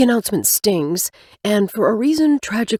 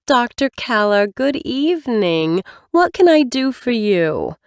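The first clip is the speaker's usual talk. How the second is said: lightly voiced chipper receptionist; bright professional phone greeting, lightly voiced; dating specialist; 6 semitones higher than usual. Same specialist voice.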